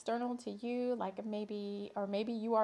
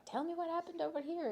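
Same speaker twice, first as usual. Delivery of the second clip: a higher, mock reporter's question; nosy reporter; 6 semitones higher, faster than usual. Imitating Heather the reporter questioning people, higher mock voice. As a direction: play the eager roving reporter. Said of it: "I have to do her accent later".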